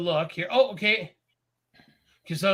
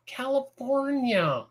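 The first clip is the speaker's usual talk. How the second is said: put-on slow, drawn-out, higher movie-kid voice; movie line quote; 5 semitones higher, much slower than usual. Quoting the California line from the movie, slow and drawn out. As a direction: stretch the word out like the kid in the film. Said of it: "no, that line".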